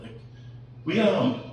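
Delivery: ordinary delivery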